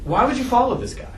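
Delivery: normal talking voice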